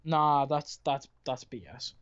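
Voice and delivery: ordinary delivery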